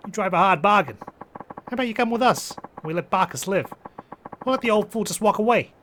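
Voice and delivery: high-pitched Jersey mob negotiator; Jersey accent. Acted. Mobster bargaining over an old man's life, Jersey accent. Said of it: "Japanese mafia member has a Jersey accent".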